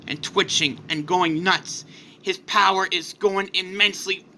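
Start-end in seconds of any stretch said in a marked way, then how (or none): none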